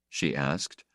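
plain reading voice

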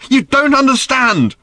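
high, loud, drawn-out outburst; angry shout. A narrator's reading. Random leaps up at her father, shouting. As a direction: yell it in full fury.

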